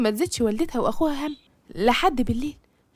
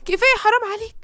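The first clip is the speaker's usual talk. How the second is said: high, louder pleading outburst; breaking down; 8 semitones higher, 4 dB louder than usual. A narrator's reading. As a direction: cry out at the end of your tether. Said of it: "أحلام بانهيار". (بانهيار، صرخة توسل عالية)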